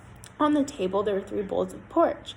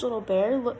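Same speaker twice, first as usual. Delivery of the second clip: plain reading voice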